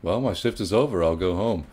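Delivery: ordinary talk, nothing marked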